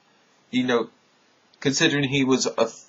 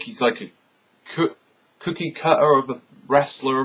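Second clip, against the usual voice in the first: ordinary delivery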